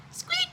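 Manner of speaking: very high tiny mouse squeak; mock squeak. A narrator's reading.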